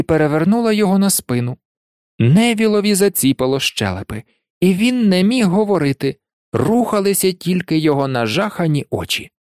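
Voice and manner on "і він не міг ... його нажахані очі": ordinary delivery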